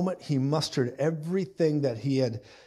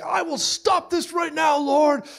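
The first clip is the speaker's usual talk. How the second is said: high shouted mock hero line; action hero Peter; 12 semitones higher, slower than usual. Preacher voicing Peter as an action hero, high mock shout. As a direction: play it like a cheesy action movie.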